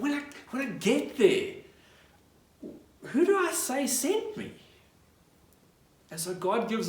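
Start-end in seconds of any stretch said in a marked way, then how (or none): 0.0-4.5 high-pitched, acted worried question; anxious questioning. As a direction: play a reluctant Moses, voice climbing high. Moses voiced making excuses to God.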